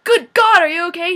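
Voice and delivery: frantic yell of concern; loud alarmed cry. Retold or imitated. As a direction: burst out in alarm. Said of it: "He's not like".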